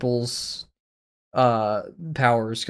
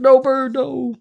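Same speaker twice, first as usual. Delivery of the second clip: high, louder mock protest; mock plea; 12 semitones higher, 6 dB louder, slower than usual. Streamer begging Bird to stay, high mock protest. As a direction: beg Bird not to go, hammed up. Pleading with Bird not to leave.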